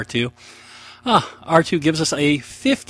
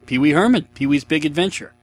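normal talking voice

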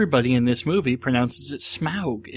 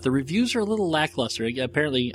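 ordinary talk, nothing marked

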